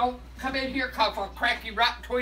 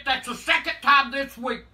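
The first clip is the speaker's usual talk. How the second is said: ordinary delivery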